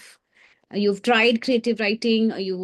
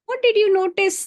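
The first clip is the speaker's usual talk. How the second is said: eager prompt; 9 semitones higher, 4 dB louder than usual. Real, not acted. Teacher urges silent class.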